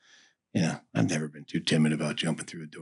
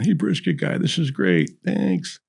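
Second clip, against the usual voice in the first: higher, breezy passing thanks; ballplayers in line; 10 semitones higher than usual. Retold or imitated. Ballplayers thanking the brisket guy in the chow line, breezy and higher. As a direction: toss it off like a pro athlete grabbing a plate. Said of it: "all those guys coming through the chow line".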